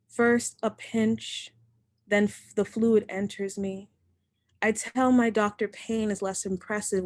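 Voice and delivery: measured, deliberate poem reading; poetry reading. Acted. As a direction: let each image land with a pause. Reads a poem aloud.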